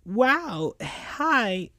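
high cartoon sidekick hello; lightly squeaky surprised greeting; Tails, greeting guests. A narrator's reading.

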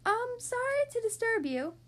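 high, tentative small voice; little high-pitched apology. A narrator's reading. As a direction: politely call out from far above. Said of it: "a small voice called out".

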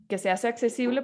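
ordinary talk, nothing marked

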